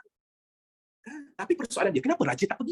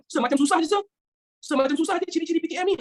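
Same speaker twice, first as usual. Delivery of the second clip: higher, whiny student voice, teasing; mock student complaint; 7 semitones higher than usual. Teacher mimicking students, mock whiny complaint. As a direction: play the student groaning it's hard.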